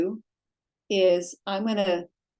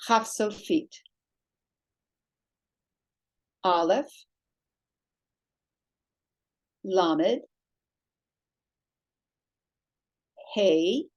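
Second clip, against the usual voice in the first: slow, clear letter-by-letter naming for students; naming letters aloud; much slower than usual. Shown as a demonstration. Teacher naming Hebrew letters for beginner students, slow and clear. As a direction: model each letter name so the class can follow. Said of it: "So what is this?".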